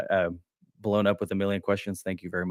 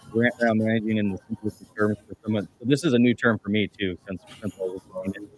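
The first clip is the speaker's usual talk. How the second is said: muffled, as if through a hand. Real, not acted. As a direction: voice muffled by the mic. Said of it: "Sorry, you're kind of muffled".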